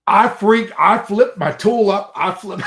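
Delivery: high, loud, agitated retelling; animated storytelling at full tilt; worked up. Retold or imitated. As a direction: relive the freak-out, tipping into laughter. Reliving his panic at the iguana.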